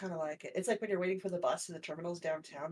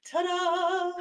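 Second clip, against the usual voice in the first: excited ta-da; 9 semitones higher, 4 dB louder than usual. Real, not acted. Grand arrival announcement.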